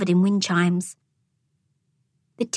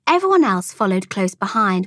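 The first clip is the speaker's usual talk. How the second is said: plain reading voice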